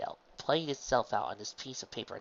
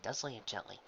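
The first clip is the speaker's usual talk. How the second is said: ordinary delivery, nothing marked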